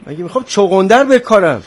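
loud, high exasperated comeback; irritated retort. Acted. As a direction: throw it back sharply, annoyed. Irritated retort to water offer.

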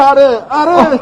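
high, slow, laughing confirmation; joyful laughing. Acted. Deputy assuring the father she is home, laughing joyfully. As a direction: laugh with relief as you confirm.

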